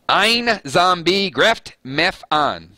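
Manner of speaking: butchered German read with a Deep South drawl; German with a Southern twang. Shown as a demonstration. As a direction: sound out "Ein Zombie grift mef an" slowly in a Southern accent. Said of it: "I'm gonna put a little Deep South flavor".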